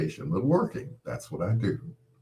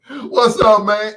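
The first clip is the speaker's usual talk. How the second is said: much higher, louder buddy greeting; suddenly the excited old friend; high, loud what's up; voice jumps up; 13 semitones higher, 11 dB louder, slower than usual. Retold or imitated. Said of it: "his voice changed".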